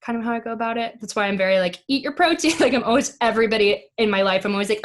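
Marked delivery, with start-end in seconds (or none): 1.9-2.5 high-pitched mock order; playful nagging. Telling everybody in her life to eat, playful nagging. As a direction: nag them lightly, pitched well up.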